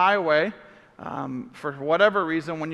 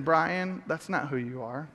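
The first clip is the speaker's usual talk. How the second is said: gentle conviction; 4 semitones lower, 7 dB quieter, slower than usual. Retold or imitated.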